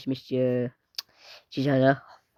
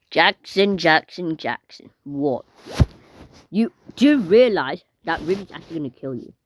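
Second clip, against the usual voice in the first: normal talking voice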